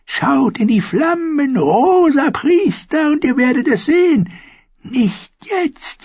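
put-on high, eerie old woman's voice; prophesy like a strange old crone; high crone telling her dreams; old dwarf seeress. A narrator's reading. Seeress warns the red priest.

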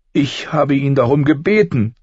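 put-on high girl's voice, weeping; crying girl. A narrator's reading. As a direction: daughter crying out her guilt. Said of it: "weinte sie". (weinend, hohe Mädchenstimme)

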